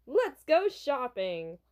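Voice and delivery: slow, drawn-out eager announcement; playful excitement. Acted. Pop star announcing a mall trip to his bodyguard, playfully excited. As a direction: stretch it out with gleeful eagerness.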